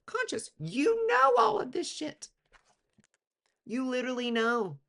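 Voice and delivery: exaggerated high-pitched insistence; squealing emphasis. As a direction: stretch it out in a shrill squeak to drive it home. Emphatic point about known exhaustion.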